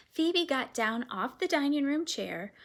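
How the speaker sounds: ordinary delivery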